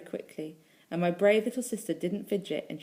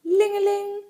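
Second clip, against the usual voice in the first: high, loud imitated bell ring; bicycle bell; 9 dB louder, slower than usual. A narrator's reading. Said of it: "said Mister Coco Jones's bell".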